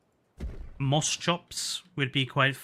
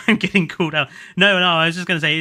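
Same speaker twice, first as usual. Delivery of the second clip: laughing protest; 5 semitones higher, 10 dB louder, faster than usual. Real, not acted. Laughing protest at being teased.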